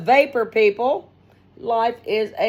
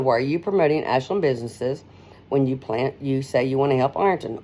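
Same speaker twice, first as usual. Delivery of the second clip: lower, even, matter-of-fact reading; lower, level read of the question; plain question; 7 semitones lower than usual. Shown as a demonstration. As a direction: ask it as a simple question. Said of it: "this is how somebody takes it".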